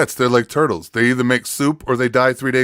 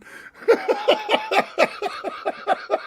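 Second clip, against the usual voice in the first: squealing burst of laughter; high shrieking laugh; 4 dB quieter than usual. Real, not acted. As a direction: let out a high wheezy laugh. Laughing at the joke.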